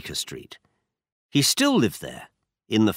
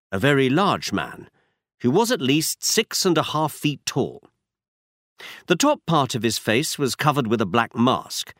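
usual speaking voice

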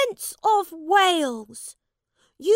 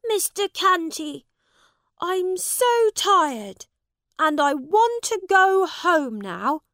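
begging; 11 semitones higher, 10 dB louder than usual. Acted. Tired prince begs the man for help, pleading. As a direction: plead with him to take you home. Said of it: "begged the prince".

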